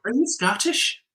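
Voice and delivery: high, hammed-up bad Irish accent; crap Irish accent. Irish girl in a Dublin bar, bad Irish accent. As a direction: put on a clumsy Irish accent. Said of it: "really crap Irish accent".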